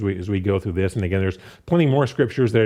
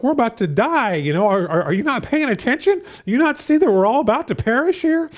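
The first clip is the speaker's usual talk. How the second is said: high-pitched, acted panic of disciples; frantic mock protest, much higher voice; panicked; 11 semitones higher than usual. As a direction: plead in alarm, pitch jumping way up. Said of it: "they're all panicked".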